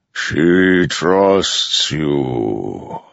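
very slow, immense godlike voice; Storm Father's voice. A narrator's reading. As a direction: let each word roll like distant thunder.

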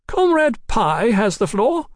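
high, loud, stately announcement; gracious chairwoman. A narrator's reading. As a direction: grant the floor with poise. Bartlett grants floor graciously.

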